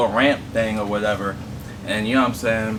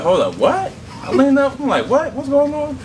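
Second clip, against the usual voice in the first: alarmed; 4 dB louder than usual. Retold or imitated. Realising the car won't stop.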